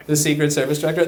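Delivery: ordinary talk, nothing marked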